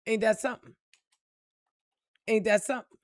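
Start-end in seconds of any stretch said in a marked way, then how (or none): none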